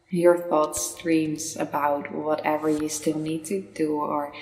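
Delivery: calm meditation guide, long pauses between phrases; unhurried, soothing meditation voice; guided meditation. Real, not acted. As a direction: lead the listener gently inward, leaving space after each phrase.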